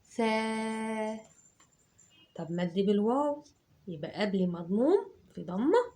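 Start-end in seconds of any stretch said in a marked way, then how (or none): 0.0-1.2 "ثا" held long as thaa; teacher showing the long vowel; long tha. Shown as a demonstration. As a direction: stretch the alif in "ثا". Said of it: "يبقى هنا حركة طويلة".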